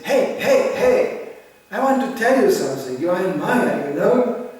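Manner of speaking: loud, high-pitched scolding call; harsh, loud guru lecturing; tired guru scolding. Retold or imitated. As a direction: shout the warning at a tired follower. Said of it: "they say".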